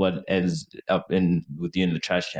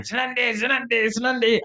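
imitated screaming. Drunk friend screaming for his crush, high mock scream. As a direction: cry the name repeatedly in a strained high voice. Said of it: "he starts screaming his name".

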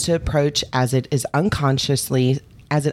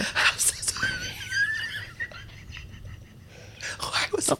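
breathy whisper through giggles; 12 dB quieter than usual. Real, not acted. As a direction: hush it, barely voiced. Told to be quiet, whispers.

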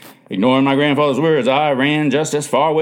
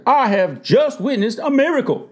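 high-pitched, awed declaration; astonished; 10 semitones higher than usual. A narrator's reading. Doctor at the bedside seeing a miracle, astonished. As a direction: announce it in wonder, pitched up. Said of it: "then to astonishment".

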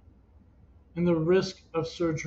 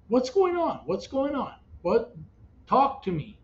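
high-pitched, pleading questions; re-enacted frantic questioning, not shouted; retold panicked demand; 7 semitones higher than usual. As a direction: press for answers, starting high and coming down. Retold panicked demand to his father.

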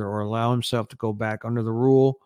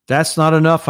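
raised, louder indignant protest, read aloud; indignant shout; 6 semitones higher, 10 dB louder than usual. A narrator's reading. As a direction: narrator lifts pitch and volume in indignation. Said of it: "raising his voice in an indignant shout".

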